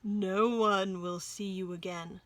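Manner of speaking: slow, sinister fox aside; to himself. A narrator's reading. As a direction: menace him under his breath, slowly. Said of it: "said the fox to himself".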